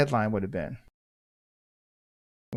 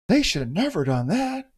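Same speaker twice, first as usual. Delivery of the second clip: mock judgmental critic; 7 semitones higher than usual. Voicing judgmental armchair critic.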